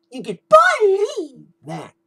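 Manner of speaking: mock Bo Dallas catchphrase, high and slow; Bo Dallas impersonation. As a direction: stretch it out in a hammed-up high voice. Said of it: "believe" impersonation".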